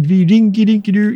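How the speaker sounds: high, bouncy Muppet-chef gibberish; Swedish Chef. Retold or imitated. Host imitating the Muppet Show's Swedish Chef, high and bouncy. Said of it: "flashbacks of the ... puppet from the Muppet Show".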